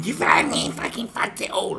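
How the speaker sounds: high, loud screamed accusation; hammed-up rage screech; mock furious yell. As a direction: shriek it like a crazed attacker. Voicing the angry extreme.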